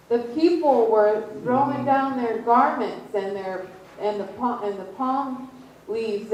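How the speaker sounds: ordinary talk, nothing marked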